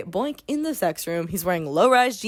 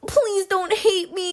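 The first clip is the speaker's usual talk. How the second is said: high, slow whiny plea; mock pleading; 9 semitones higher, slower than usual. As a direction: whimper it like a pathetic boyfriend.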